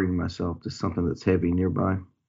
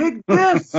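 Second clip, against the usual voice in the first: high, loud southern challenge; southern-accented attacking yell; shouted southern accent; 9 dB louder than usual. Acted. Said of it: "I will turn around and shout".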